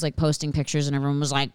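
normal talking voice

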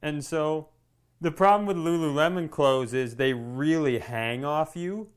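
usual speaking voice

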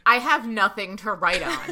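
laughing complaint. Retold or imitated. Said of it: "I've said out loud".

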